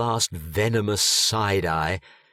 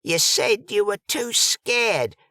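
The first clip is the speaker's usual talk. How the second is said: much higher, fluttery fortune-teller voice; 10 semitones higher than usual. A narrator's reading. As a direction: gush about the stars, way up high.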